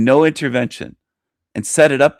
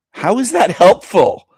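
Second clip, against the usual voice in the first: indignant, much higher and louder; exasperated outburst; 9 semitones higher, 7 dB louder than usual. Real, not acted. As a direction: demand an answer, fed up. Exasperated rant about God.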